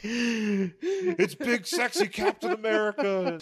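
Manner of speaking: high, drawn-out hype call; hyped announcer. Retold or imitated. Co-host hypes a mock wrestling entrance, announcer voice. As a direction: sell the entrance to the crowd.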